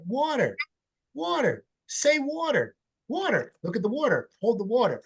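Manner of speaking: put-on high singsong baby talk to a small child; parent teaching toddler. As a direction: coo the word water high to a little kid. Teaching a toddler the word water.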